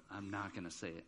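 put-on low, very soft child's refusal; barely voiced, as if afraid to say it; hushed refusal. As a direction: mimic a kid quietly refusing. Child refusing to say bad word.

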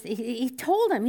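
usual speaking voice

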